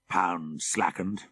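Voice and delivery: ordinary delivery, nothing marked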